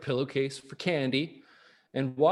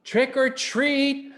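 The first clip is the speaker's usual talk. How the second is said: a little louder; 9 semitones higher, 5 dB louder, slower than usual. Acted. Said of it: "He said it a little louder".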